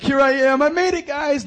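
high, loud hammed-up boast; very high, loud jokey bragging; mock triumph. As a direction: announce your victory to the crowd. Boasting after reaching deep end.

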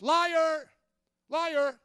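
accusing "Liar"; loud accusation. Real, not acted. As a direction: call out the lie. Pastor rejects a false excuse.

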